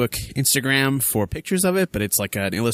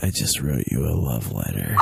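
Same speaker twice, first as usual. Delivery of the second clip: low voice; 13 semitones lower than usual. Pretend. Low mock voice.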